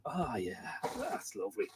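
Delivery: near-whisper, lost in the music; hushed blissful murmuring to a late-night tune; dreamy hushed murmur. Retold or imitated. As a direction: breathe it out softly like you're entranced. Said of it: "you're just going".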